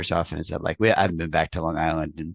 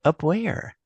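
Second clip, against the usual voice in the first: put-on higher, puzzled question from the new kid; mock confusion; 11 semitones higher than usual. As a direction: ask it lightly, as if truly lost. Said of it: "And I'm like".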